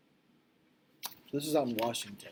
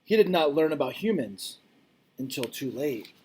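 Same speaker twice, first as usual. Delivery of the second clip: louder, expressive read-aloud voice; reading aloud to class; 2 semitones higher, 7 dB louder than usual. Acted. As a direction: read it out like a storybook for kids. Reads picture book to class.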